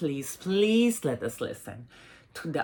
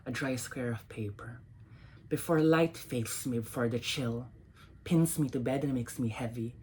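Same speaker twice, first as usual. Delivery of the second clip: soft, measured poem reading with long pauses; poetry reading; 3 semitones lower, 5 dB quieter than usual. Acted. Reading her poems to an audience, soft and measured. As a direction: let each image hang before the next line.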